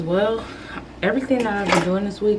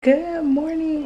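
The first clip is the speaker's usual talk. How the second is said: bright greeting; 10 semitones higher, 4 dB louder, much slower than usual. Real, not acted. Vlogger greets viewers on waking.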